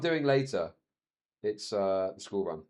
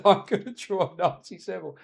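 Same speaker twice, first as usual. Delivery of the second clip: a hint of a cheeky boy, higher; lightly voiced young son; 5 semitones higher, 3 dB louder than usual. To viewers, lightly voicing his son's cheeky threat. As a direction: quote the son with a giggle.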